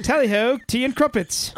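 high, hammed-up British accent. Shown as a demonstration. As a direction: jolly caricature of an English accent. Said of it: "You're in England".

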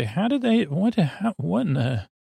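high sputtering disbelief; flustered, high-pitched awe; stunned. Retold or imitated. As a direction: lose the words in amazement. Stunned self-quote watching trick.

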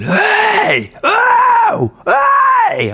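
high-pitched drawn-out holler; imitated screaming. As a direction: scream like a heckler across a room. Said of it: "this fucking guy screaming, going".

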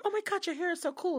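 high, light admiring imitation; happy gushing. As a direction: play the delighted, oblivious admirer.

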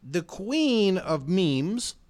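drawn out very slowly, like a herald; a slow, stately introduction; grand announcement. Pretend. Grand announcement of listener title.

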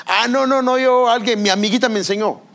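imitando a una niña respondona, aguda y fuerte; niña rechazando. Retold or imitated. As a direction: rechazo altivo de niña, en burla. Hija rechaza a su mamá. (imitación de hija respondona)

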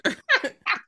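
squealing burst of laughter; loud high-pitched cackle. Real, not acted. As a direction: shriek with laughter. Cracking up at the story.

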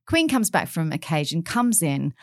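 normal talking voice

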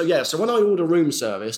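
ordinary talk, nothing marked

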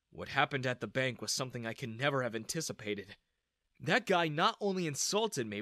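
plain reading voice

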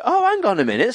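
high-pitched, put-on protest; mock indignation. Sibling confronting brother over music, mock indignant. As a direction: pull someone up with playful outrage.

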